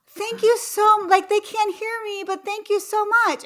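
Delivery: high-pitched gushing gratitude; overjoyed, high thank-you; delighted thanks. Retold or imitated. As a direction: gush thanks, voice lifted high. Retells her delighted thanks.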